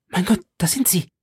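high, hushed panic; frightened. Acted. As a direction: terror kept under breath. Milady panisch aber leise. (ängstlich, hoch und gedämpft)

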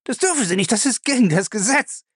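high, panicked protesting; frightened pleading at a very high pitch; horrified cry. A narrator's reading. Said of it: "schrie Lacey entsetzt auf".

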